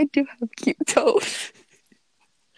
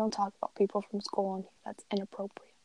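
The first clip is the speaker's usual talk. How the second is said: whispering; 8 dB quieter, faster than usual. Real, not acted. Hushed scolding and reply.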